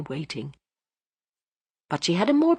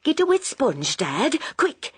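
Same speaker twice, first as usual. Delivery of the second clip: higher, urgent call to her father; crying out; 10 semitones higher than usual. A narrator's reading. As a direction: hurry him, urgent and raised. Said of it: "she cried".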